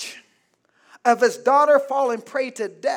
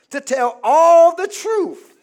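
louder and higher, all the truth stretched slowly; raised, slowed preaching emphasis; building emphasis; 6 semitones higher, 8 dB louder, slower than usual. Acted. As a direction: swell it for the punchline. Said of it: "Here's the key words".

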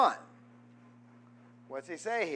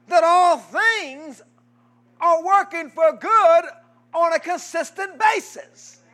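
loud, higher preaching cadence; 7 semitones higher, 8 dB louder than usual. Shown as a demonstration. Preacher driving the verse home to the congregation, loud and high. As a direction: hammer it home to the church. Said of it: "Let that soak in".

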